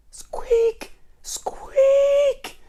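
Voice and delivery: very high, loud squeaking mouse. Acted. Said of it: "the noisiest mice in the world".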